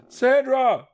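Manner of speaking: very high, loud shriek of her name. Acted. Johnny shrieking for his lost sister, very high and loud. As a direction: scream her name into the fog. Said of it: "he shrieked".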